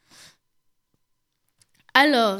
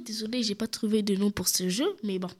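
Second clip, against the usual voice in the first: quick, low, softer apology; apologetic aside; 5 semitones lower, 8 dB quieter, faster than usual. Real, not acted. Presenter excuses the nameless game, quick apologetic aside. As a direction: drop into a hurried little aside.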